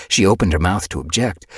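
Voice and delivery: ordinary delivery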